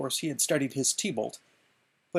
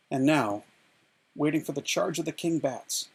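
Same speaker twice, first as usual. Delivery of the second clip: ordinary delivery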